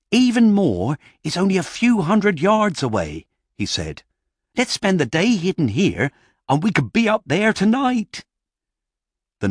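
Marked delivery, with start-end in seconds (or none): 0.1-3.3 high-pitched, raised character voice; gnome leader's announcement. Acted. Gnome leader addresses gathered gnomes. 4.6-8.2 high-pitched, raised character voice; gnome leader's announcement. Acted. Gnome leader proposes plan to gnomes.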